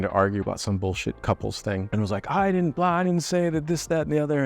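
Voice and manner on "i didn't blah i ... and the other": high, whiny defensive protest; whiny mock protest. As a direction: play yourself squeaky and defensive mid-argument. Mocking own side of couples argument.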